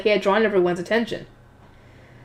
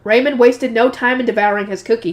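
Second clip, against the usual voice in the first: ordinary delivery, nothing marked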